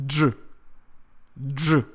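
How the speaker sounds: D and ZH run together as "Zh"; Russian dzh sound. Shown as a demonstration. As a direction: say "Zh" as a hard d plus zh. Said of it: "they pronounce it like this".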